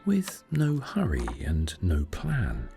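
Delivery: usual speaking voice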